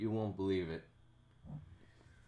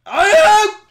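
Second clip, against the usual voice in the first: high loud scream; startled shriek; 21 dB louder than usual. Real, not acted. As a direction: shriek in shock. Sudden shriek at fingers.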